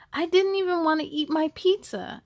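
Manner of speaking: plain reading voice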